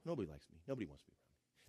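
very soft, low mopey parody; mock self-pity. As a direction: sulk it almost under your breath. Mock self-pity, nearly muttered.

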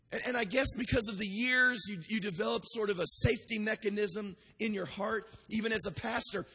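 usual speaking voice

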